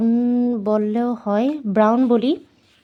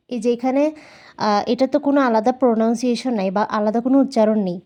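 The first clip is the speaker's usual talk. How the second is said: normal talking voice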